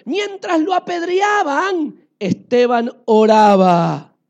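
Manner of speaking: loud, slow, booming proclamation; proclaimed climax. A narrator's reading. Preacher to the congregation, booming out the stoning scene. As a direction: boom it over the crowd.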